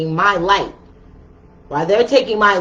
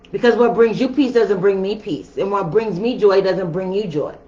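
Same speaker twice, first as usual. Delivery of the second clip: normal talking voice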